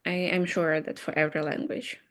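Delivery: ordinary talk, nothing marked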